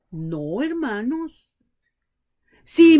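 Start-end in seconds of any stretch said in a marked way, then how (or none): none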